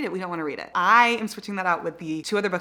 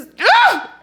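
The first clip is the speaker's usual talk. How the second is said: very high, loud squeal-gasp; short high burst of excitement; excited gasp; 12 dB louder than usual. Real, not acted. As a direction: gasp as if overwhelmed. Overwhelmed by the book.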